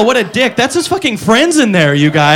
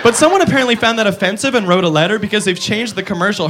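ordinary talk, nothing marked

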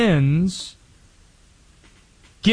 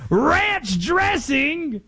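put-on very slow, much higher British accent; a British tourist gawping in wonder; hammed-up British accent, amazed; bad British accent; 12 semitones higher, much slower than usual. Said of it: "international fan".

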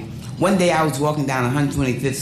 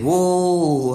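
drawn-out stage call with falling end; a lead singer holding out the mic for a reply; singer's call; 4 semitones higher than usual. Retold or imitated. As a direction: croon it like a showman coaxing the crowd. Singer calling to the audience.

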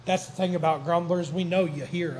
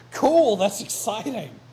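excited; 4 semitones higher, 5 dB louder than usual. Acted. Zacchaeus voiced excitedly in sermon.

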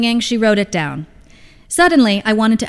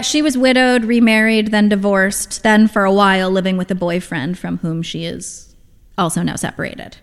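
plain reading voice

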